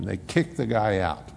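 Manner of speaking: usual speaking voice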